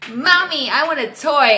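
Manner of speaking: high imitated child's plea; whining child. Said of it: "And not just".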